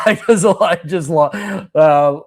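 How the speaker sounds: breaking into a laugh mid-word. Real, not acted. Laughing at the irony.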